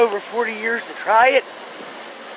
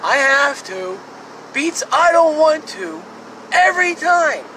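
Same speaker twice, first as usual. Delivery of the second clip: pitched up, insisting; higher, like a nagging duty that wins; higher insistent; 8 semitones higher than usual. Shown as a demonstration. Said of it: "Gets beat out by".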